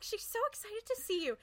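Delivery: high-pitched, over-excited hostess; mock excited. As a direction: gush in a high imitation of an eager greeter. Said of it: "talks like this".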